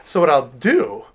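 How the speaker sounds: ordinary talk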